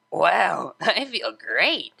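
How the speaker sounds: slow, dreamy, dazed laugh; woozy and giddy, drawn out; delirious. Acted. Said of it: "overexerting magic made unicorns delirious".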